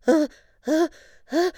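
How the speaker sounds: building up to a big sneeze; uh uh then a loud high sneeze; sneezing. Acted. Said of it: "said the poster of Queen Moo".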